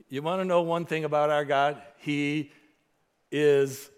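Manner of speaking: loud, high, ringing pulpit voice; fiery sermon climax; full-force preaching. Acted. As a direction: preach it out to the whole room.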